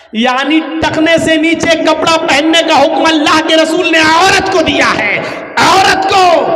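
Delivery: fiery preaching. Acted. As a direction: hammer the rule home. Preaching at full force.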